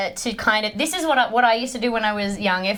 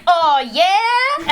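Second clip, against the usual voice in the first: hammy announcement; 8 semitones higher, 5 dB louder than usual. Retold or imitated. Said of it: "I just go like".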